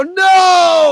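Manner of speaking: put-on high, loud kids' no; kids shouting. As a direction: shout no as the audience. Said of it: "And the kids would go".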